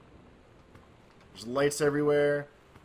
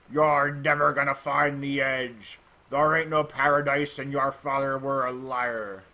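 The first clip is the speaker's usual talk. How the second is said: slow, loud, menacing pirate; 4 dB louder, slower than usual. Acted. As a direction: drag out the threat like a pirate captain. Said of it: "my pirate voice".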